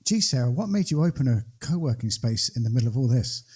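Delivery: ordinary talk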